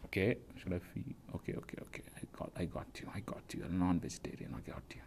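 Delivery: whispering. Real, not acted. Hushed aside after bad joke.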